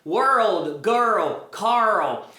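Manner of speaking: put-on world, girl, Carl each ending with a clear L; demonstrated L sound. Shown as a demonstration. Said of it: "esse som de L". (world, girl, Carl com L marcado)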